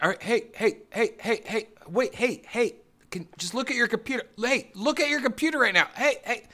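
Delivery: high, urgent mock voice, insistently demanding attention; scam-baiter. Scam-baiter interrupting a ranting scammer, high and insistent. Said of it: "the guy's just like".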